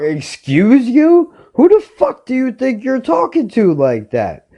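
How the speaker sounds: high-pitched, incredulous retort; indignant comeback. Retold or imitated. Snapping back at a rude dog owner, indignant and high. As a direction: act out an offended challenge, pitch jumping way up.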